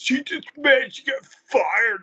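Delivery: high, sneering taunt at the fired wrestler; mocking fan taunt. Mocking fans about wrestler.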